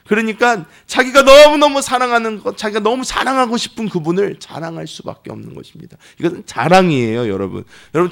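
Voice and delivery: full-force pulpit crescendo; fervent preaching. Acted. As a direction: preach it with rising fire. Preaching climax.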